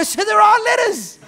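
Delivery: very loud, high, slowed gotcha; triumphant laughing shout. Retold or imitated. Priest catching the wife's slip, shouting with laughter. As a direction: pounce on her slip.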